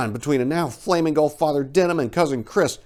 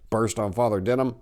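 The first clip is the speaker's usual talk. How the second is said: ordinary delivery, nothing marked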